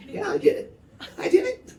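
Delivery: put-on high, breathy kid's reply; proud little kid; child's voice. Child answering the dad.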